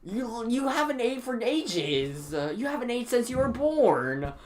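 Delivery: high, slow, drawn-out mock scolding; exasperated scolding. Student telling picky classmate to eat, exasperated scolding. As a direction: nag in hammed-up exasperation.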